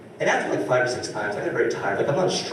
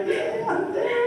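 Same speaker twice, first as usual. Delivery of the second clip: mock eager; slower than usual. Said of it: "And I was like".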